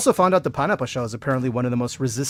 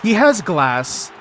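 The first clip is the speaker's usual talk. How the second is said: dismayed disbelief; 5 semitones higher, 6 dB louder, slower than usual. Real, not acted.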